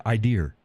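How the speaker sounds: "I dear" ending on a sounded R; added final R. Shown as a demonstration. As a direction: put an R on idea, "I dear". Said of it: "and drop the R".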